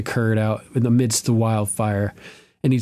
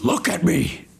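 Joker line; 6 semitones higher than usual. Retold or imitated. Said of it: "the Hound has the Joker moment".

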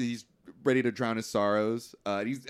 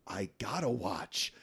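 hurt Paulie; 2 semitones higher, 6 dB louder, much slower than usual. Retold or imitated. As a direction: drag it out, then push the second line. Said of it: "and Paulie says".

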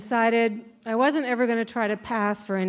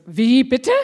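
loud, higher, sharp rising question; incredulous German teacher; 5 semitones higher, 9 dB louder than usual. Retold or imitated. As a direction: imitate a teacher demanding a repeat in German. Teacher cannot hear the answer.

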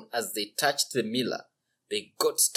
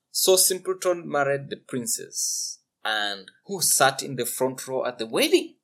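usual speaking voice

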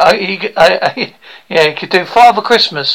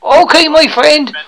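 cheery rural British bumpkin, higher voice; eager would-be actor; 8 semitones higher than usual. Acted. Pupil says goodbye to coach, cheery bumpkin voice. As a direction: sign off brightly in a thick country accent.

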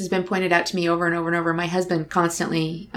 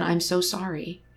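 much softer, slow and gentle; tender condolence; 3 semitones lower, 8 dB quieter, slower than usual. Retold or imitated. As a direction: offer the sorrow quietly, right beside her. Consoling grieving stranger.

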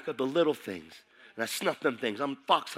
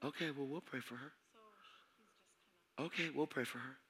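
quiet aside; 3 semitones higher, 13 dB quieter than usual. Real, not acted. Pastor quietly answering someone in the room, soft aside. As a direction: murmur it gently aside.

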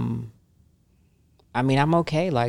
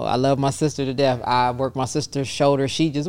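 ordinary talk, nothing marked

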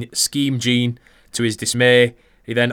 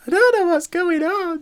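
very high, squeaky mock falsetto; bewildered woman. Co-host left alone on the podcast, bewildered falsetto imitation. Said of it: "she was just like".

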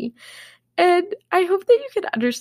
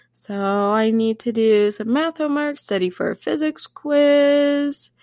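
acted planner check; 6 semitones higher than usual. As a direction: imitate yourself reeling off homework. Acts out reading her planner list.

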